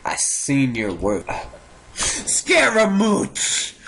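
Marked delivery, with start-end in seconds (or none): 1.9-3.7 high, drawn-out theatrical call; dramatic calling out. Pretend. Gamer hails a suspect, dramatic cry. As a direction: call the name out high and long.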